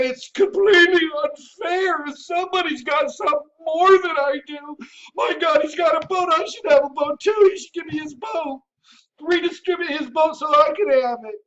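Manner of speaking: high-pitched, indignant mock whining; mock entitled complainer. Host imitating entitled people jealous of a boat, mock complaint. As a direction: complain in a high voice, demanding what others have. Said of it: "they think that life should be handed".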